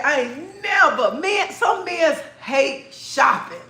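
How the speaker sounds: high, drawn-out pronouncement; exasperated emphasis. Retold or imitated. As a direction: stretch it out with amused exasperation. Exasperated verdict, repeated for effect.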